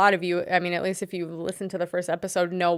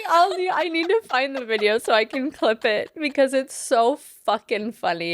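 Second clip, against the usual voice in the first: laughing; 5 semitones higher than usual. Real, not acted. Cracking up over the video.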